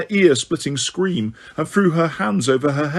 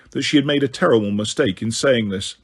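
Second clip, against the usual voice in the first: ordinary delivery, nothing marked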